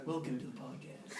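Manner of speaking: hushed late-night radio voice. Pretend. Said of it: "practice my radio voice".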